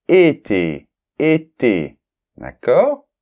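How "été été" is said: été with both vowels a closed é; closed é demo. Shown as a demonstration. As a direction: say été loud and distinct, tight é sounds. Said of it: "ça a une influence sur la prononciation".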